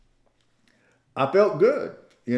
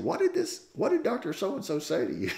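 high-pitched, puzzled question; confused; 7 semitones higher than usual. Retold or imitated. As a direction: imitate a baffled professor asking, pitched way up. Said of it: "he looked kinda confused".

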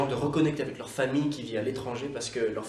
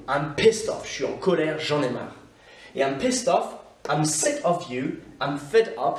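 ordinary delivery

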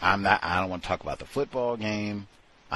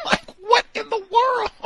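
laughing disbelief; 7 dB louder than usual. Real, not acted.

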